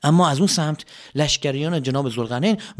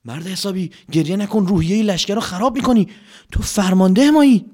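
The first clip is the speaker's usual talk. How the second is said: scolding commander; 6 semitones higher than usual. Acted.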